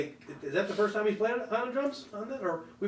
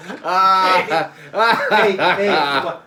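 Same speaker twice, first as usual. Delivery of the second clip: loud comic call; 11 dB louder, much slower than usual. Acted. Launching into a comic bit.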